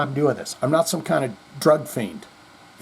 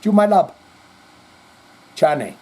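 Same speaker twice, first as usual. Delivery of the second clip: put-on higher, fond Spanish-accented I love you; imitating a Spanish accent; 6 semitones higher, 3 dB louder than usual. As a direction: fondly imitate an old coworker with a Spanish accent. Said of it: "But he".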